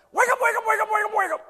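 put-on high, loud child calling wake up; eager toddler waking his parents; little boy's voice. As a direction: chirp it like an excited little boy. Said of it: "that little voice right now going".

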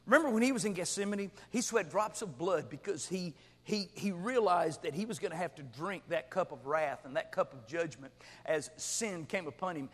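normal talking voice

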